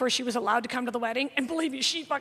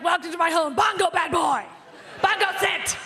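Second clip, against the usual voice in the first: raspy hostess scolding dog. Retold or imitated. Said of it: "She's like".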